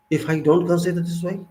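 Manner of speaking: ordinary delivery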